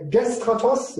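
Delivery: gestratos with a high, rising tone. Shown as a demonstration. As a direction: lift "gestratos" up high at the end. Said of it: "it's a high tone".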